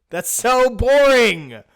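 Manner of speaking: high, loud and slow groan; loud drawn-out complaint. Real, not acted. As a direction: stretch out the boring, loudly.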